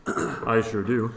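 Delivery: low, slow radio voice. Pretend. Co-host showing off his radio voice on request, radio voice. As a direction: draw it out deep, like a radio host. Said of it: "you got your radio voice on".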